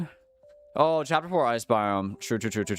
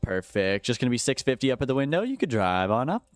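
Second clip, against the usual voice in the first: higher, bright drive-thru cashier; upbeat cashier taking an order, higher; customer service voice; 3 semitones higher than usual. Shown as a demonstration. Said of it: "my customer service voice".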